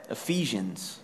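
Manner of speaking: usual speaking voice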